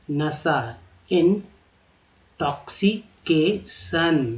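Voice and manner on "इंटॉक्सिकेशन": इंटॉक्सिकेशन stretched out syllable by syllable; very slow demonstration. Shown as a demonstration. Teacher drawing out इंटॉक्सिकेशन very slowly for learners. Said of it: "देखिए, सन हो गया".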